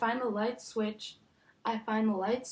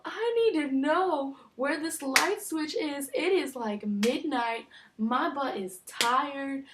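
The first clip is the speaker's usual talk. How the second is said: higher, louder desperate begging; begging a sleepy bunkmate for the light switch; exasperated pleading; 4 semitones higher, 3 dB louder than usual. Retold or imitated. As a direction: beg her, climbing higher with each please.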